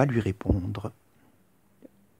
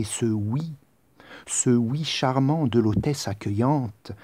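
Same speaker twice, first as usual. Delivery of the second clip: normal talking voice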